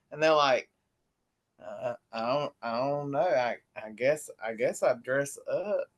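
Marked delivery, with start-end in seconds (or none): 1.6-5.8 hesitant, lower and softer mock answer; low, dull, unsure imitation; imitating clueless interviewees. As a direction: mumble it haltingly, like a stumped interviewee. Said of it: "that's what they sound like".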